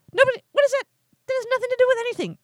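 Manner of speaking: shrill-free outraged disbelief, fast and high; incredulous outburst. Real, not acted. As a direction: throw up your hands: what is that?. Outraged at the Europe reply.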